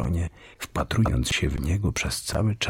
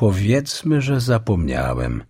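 starts high and loud, then drops coolly; cold; 5 semitones higher, 5 dB louder than usual. A narrator's reading. Aslan answering the witch's challenge, coldly. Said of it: "odpowiedział Aslan chłodno".

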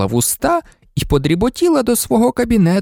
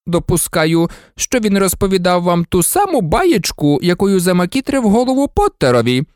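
sour; 7 semitones higher than usual. A narrator's reading. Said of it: "скривився Снейп".

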